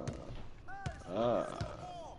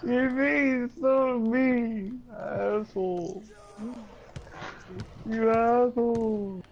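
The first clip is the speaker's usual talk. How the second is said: mock-hurt whining; 9 semitones higher than usual. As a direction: wail it high like a sore loser. Playful complaint after losing.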